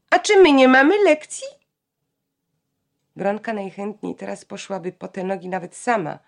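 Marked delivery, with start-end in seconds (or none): 0.1-1.5 indignant retort. Acted. Janka arguing with her mother.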